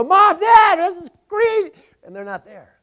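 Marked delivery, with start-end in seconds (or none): 0.0-1.7 put-on high, loud child's cry for parents; panicked child calling. Retelling scared toddlers crying out for missing parents. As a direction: call out like a scared little kid. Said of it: "And we go".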